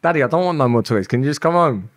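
a hint of a small child begging; little girl. Voicing his little daughter.

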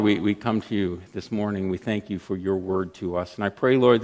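ordinary talk

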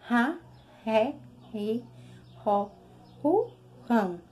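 ra, ré, ri, ro, ru, rão each with a throaty r; strong r syllables. Shown as a demonstration. Teacher recites the strong r syllables for the class. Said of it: "esse sonzinho forte".

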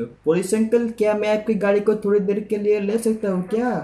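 mock-innocent child asking police uncle for his car; sweet kid's request. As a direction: ask like a small child begging for a favour. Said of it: "आपको ऐसे पूछना है police uncle".

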